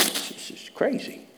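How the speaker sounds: high, slow, breathless wonder; amazed at God's presence, whispered. Retold or imitated. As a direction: marvel under your breath. Morning amazement retold.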